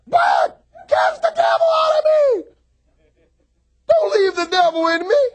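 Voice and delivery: high, loud pleading cry; preaching plea. Acted. Preacher to church, crying out for deliverance. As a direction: beg them to cast it out.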